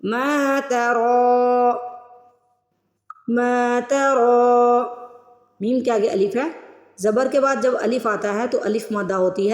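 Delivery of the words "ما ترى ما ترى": ما ترى with the alif of ما stretched long, slow tajweed; slow Quranic recitation demo; drawn-out Quran recitation. Shown as a demonstration. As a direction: recite ما ترى slowly, holding the long vowel. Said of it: "اس لفظ کو کھینچ کے ادا کرنا ہوتا ہے".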